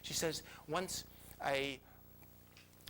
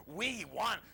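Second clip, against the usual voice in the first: louder, higher demanding body; mock scream; 4 semitones higher, 6 dB louder than usual. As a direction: cry out the body's craving, half in jest. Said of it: "my body starts screaming".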